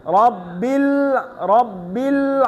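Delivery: রব্বিল said slowly and smoothly; joined রব্বিল. Shown as a demonstration. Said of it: "হবে?". (সঠিক, জোড়া লাগানো রব্বিল)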